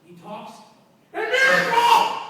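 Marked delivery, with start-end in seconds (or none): 1.1-2.2 loud, slow, high proclamation; big awestruck declaration; proclaiming a miracle. Real, not acted. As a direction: announce it like a revival preacher.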